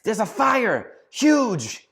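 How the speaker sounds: high, loud alarmed yell; wife yelling in alarm from the kitchen; retold yell. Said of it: "I hear her yell".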